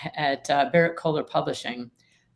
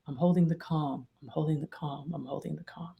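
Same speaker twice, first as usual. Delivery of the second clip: from softer to almost silent; self-talk fading to a hush; 3 semitones lower, 9 dB quieter than usual. Shown as a demonstration. Calming her own amygdala, fading to a hush. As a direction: repeat it, letting each fade away. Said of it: "I literally will say to myself".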